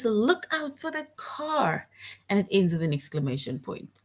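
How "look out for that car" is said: high, slow urgent warning call. Shown as a demonstration. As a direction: call it out like a car is coming. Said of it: "this one shows, again, strong feelings".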